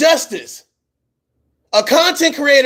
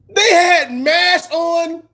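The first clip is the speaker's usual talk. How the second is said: incredulous shout; 6 semitones higher, much slower than usual. Real, not acted. Host echoing the guest's claim again, shouting in disbelief. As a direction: half-shout it back, can't believe it.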